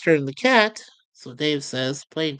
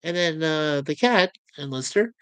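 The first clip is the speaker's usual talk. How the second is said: ordinary delivery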